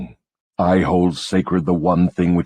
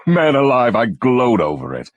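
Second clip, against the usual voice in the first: gloating; 6 semitones higher than usual. Acted. Devil gloats at accusation.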